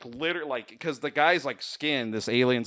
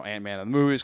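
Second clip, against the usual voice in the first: normal talking voice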